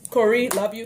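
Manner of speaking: ordinary talk, nothing marked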